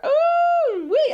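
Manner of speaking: very high, loud ooh; gleeful high ooh; excited squeal. Real, not acted. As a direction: squeal with anticipation. Excited about Peter date.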